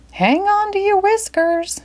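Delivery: granny calling to her cat, high; Granny calls out. Acted. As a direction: sing it out to the cat. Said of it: "Granny called to Crabapple".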